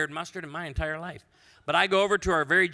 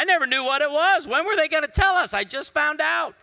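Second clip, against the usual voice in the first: loud, high-pitched comic exclamation; mock outraged surprise; 11 semitones higher, 9 dB louder than usual. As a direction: shout it like a scandalous discovery. Voices audience's surprised reaction.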